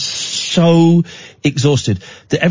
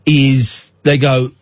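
ordinary talk, nothing marked

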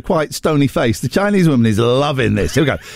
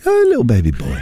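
pet voice; 3 semitones lower than usual. Retold or imitated. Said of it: "that you use when speaking to your pets".

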